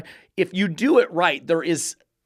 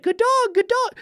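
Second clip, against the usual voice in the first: high sing-song "good dog"; mocking dog-praise voice. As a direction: parody an owner praising the dog. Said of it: "It's looking at".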